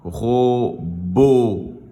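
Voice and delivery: "Robot" split in two long syllables; final-sound demonstration. Shown as a demonstration. Teacher repeating the word for pupils, stretched "Robot". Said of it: "c'est le son O".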